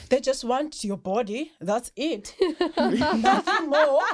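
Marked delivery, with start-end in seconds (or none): 2.4-4.0 slow, laughing "Nothing more.". Real, not acted. Laughing at own joke.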